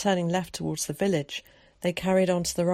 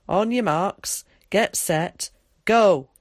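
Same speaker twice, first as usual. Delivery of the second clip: starter's call; 2 semitones higher, 7 dB louder, slower than usual. Acted. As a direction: race start building to a loud "Go". Tom starts a race.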